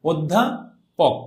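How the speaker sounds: "অধ্যাপক" very slowly, od-dha, pause, pok; teacher sounding out each part with closed o; slow pronunciation demo. Shown as a demonstration. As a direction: break "অধ্যাপক" into drawn-out syllables. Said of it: "একবার উচ্চারণ করো তো আগে".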